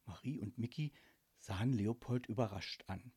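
ordinary delivery

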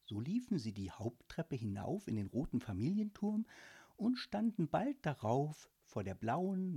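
ordinary delivery, nothing marked

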